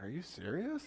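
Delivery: quiet, high rising disbelief; incredulous. Retold or imitated. New dad answers the baby doctor's casual advice, incredulous. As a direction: question it softly, pitch climbing sharply at the end.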